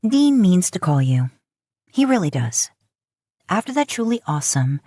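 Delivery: plain reading voice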